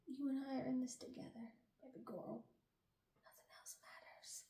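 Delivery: very soft, hushed murmur; intimate whisper. Acted. Mendex to his little pet in the boat, intimate whisper. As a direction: whisper it privately to your pet.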